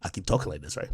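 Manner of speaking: very low, hushed imitation; Flacco. Streamer mocking how someone talks, low quiet impression. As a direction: mimic him deep down and quiet. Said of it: "Flacco voice".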